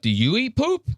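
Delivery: mock incredulous question. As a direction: squeak the question up high in mock disbelief. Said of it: "the Indian guy goes".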